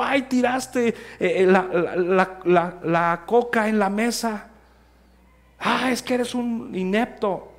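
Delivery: put-on high, loud exasperated parent scolding a child. Pastor voicing a parent scolding a child for spilling coke, high and loud. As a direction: snap at the kid like an impatient mother.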